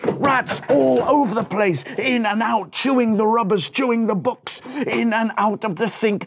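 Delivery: high, loud, breathless telling of chaos; excited. A narrator's reading. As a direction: pile it on like a panic. Frantic rat chaos narration.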